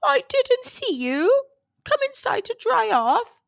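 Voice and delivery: a huge kindly woman apologising; giant woman. A narrator's reading. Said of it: "said the giant woman".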